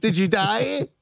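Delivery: high, rising imitation of a film line; mock movie quote. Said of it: "the Hangover Two".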